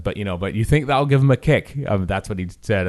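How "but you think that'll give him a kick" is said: higher, louder bad accent on "But you think that'll give him a kick?". Host quoting the actor asking about the entrance, bad accent. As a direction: ham up a borrowed accent for the actor's question. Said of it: "I'm doing a bad accent".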